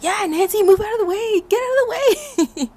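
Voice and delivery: high, loud chorus of warnings; kids shouting. Retold or imitated. Kids at the top, loudly shouting at Nancy. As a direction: yell at her to clear the way.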